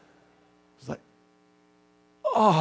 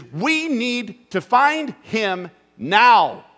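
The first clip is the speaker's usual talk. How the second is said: slow, louder and higher, every word pressed; urgent loud plea; 6 semitones higher, 7 dB louder, much slower than usual. Retold or imitated. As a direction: drop the indoor voice, insist. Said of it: "not concerned about your indoor voice".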